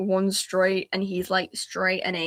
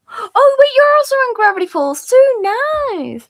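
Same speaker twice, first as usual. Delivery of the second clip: high, loud excited squeal; 11 dB louder than usual. Real, not acted. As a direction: burst out thrilled. Excited realization to chat.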